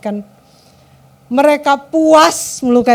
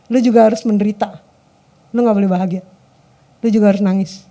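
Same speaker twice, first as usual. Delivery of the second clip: low-pitched, acted vindictive threat; mock menacing; 7 semitones lower than usual. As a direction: play a spiteful partner, pitched down. Acted vengeful partner wanting tears.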